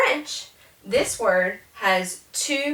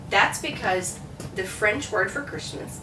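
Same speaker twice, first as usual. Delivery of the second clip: ordinary talk, nothing marked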